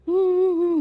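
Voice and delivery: eerie hummed whimper; imitated crying hums. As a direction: mimic someone sobbing in the next stall. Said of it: "macam gitu tau".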